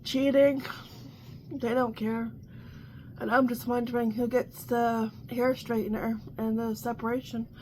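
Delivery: ordinary talk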